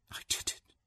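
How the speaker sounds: voiceless, awed whisper. A narrator's reading. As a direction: whisper it to yourself. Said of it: "she whispered".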